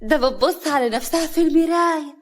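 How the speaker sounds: high mocking exclamation; sarcastic. Sister-in-law catching Hana at the mirror, mocking. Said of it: "كريمة بسخرية".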